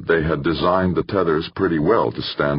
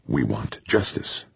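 low-key, softer demand; restrained demand; 3 semitones lower, 5 dB quieter than usual. A narrator's reading. Mob leader demanding justice for killed families, restrained. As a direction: state the demand quietly, without shouting. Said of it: "spat out a string of rapid Spanish".

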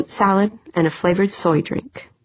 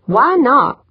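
higher-pitched pointed challenge; demanding; 6 semitones higher, 4 dB louder than usual. Acted. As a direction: demand a reason. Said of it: "Pancho demanded".

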